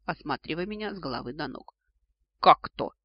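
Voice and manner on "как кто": loud indignant retort. A narrator's reading. Heroine answers the startled grandfather, indignant. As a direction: throw it back, much louder, affronted. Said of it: "возмутилась я".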